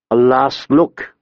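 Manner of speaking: usual speaking voice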